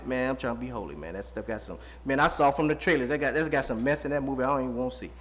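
low, soft, offhand answer; subdued reply to a pushy friend; low-key excuse. Acted. Acts out declining reply to friend.

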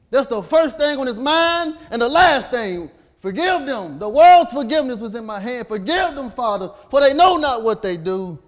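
loud, high, slowed preacher's cry; preaching at full force. Acted. Preacher voicing Jesus on the cross, loud and high. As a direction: lift forgive them to the rafters.

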